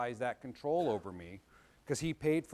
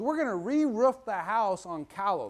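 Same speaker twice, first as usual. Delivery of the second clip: dad's order; 6 semitones higher, 6 dB louder than usual. Retold or imitated. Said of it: "He goes".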